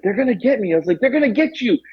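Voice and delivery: frightened insistence, lightly acted; paranoid retold panic. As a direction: act out a stoned panic, warning of attackers.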